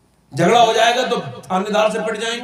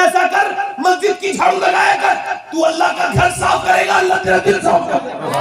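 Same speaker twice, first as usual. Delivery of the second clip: forceful saint's advice; 9 semitones higher than usual. Acted. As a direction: proclaim the remedy with force.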